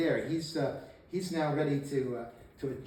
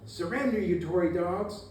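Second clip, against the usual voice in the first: mock battlefield yell, pitched up; retold yell; 4 semitones higher, 3 dB louder than usual. Eagles yells at the Virginians to surrender, mock yell. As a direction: call it out high like a taunting demand. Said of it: "Eagles yells out".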